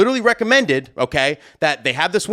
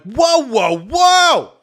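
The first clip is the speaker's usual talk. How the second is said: rising, drawn-out high whoas; calling a halt; 9 semitones higher, 4 dB louder, much slower than usual. Retold or imitated. DJ trying to stop the coordinator talking, insistent interruption. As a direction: cut in with escalating whoas.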